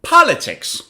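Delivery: "Politics" with POL high and punched; "Politics" falling from a strong first syllable; stress on first syllable. Shown as a demonstration. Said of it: "read the three of these words".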